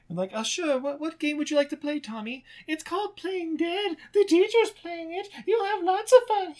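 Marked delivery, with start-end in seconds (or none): 0.3-2.4 higher, lightly humoring tone; a hint of a wary mother; mock humoring mom. The mom humoring Tommy, a hint of wariness. Voicing mom playing along. 2.7-6.5 put-on creepy child. Tommy telling the mom, in a creepy child voice.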